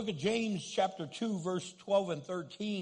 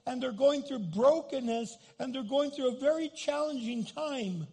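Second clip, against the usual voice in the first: higher, building repeated lines; impassioned preaching; 7 semitones higher than usual. Real, not acted. Pastor urging congregation to see hidden pain, impassioned. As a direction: build each line, rising in fervor.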